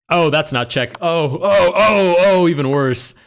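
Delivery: loud, high string of panicked ohs; alarmed dismay. Real, not acted. Chess player blunders on the clock, loudly dismayed. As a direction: cry out as the mistake keeps getting worse.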